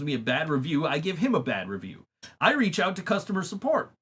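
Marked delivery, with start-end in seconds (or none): none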